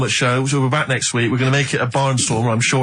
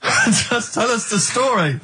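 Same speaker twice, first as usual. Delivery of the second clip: laughing; 8 semitones higher, much slower than usual. Real, not acted. Cohost can't stop laughing.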